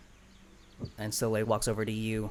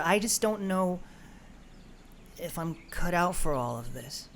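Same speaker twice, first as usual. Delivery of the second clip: higher, halting, hesitant confession; Greeble, doubting; 6 semitones higher than usual. Acted. Greeble confides her fears to the group, higher and halting. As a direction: stumble over the words, losing heart. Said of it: "and says".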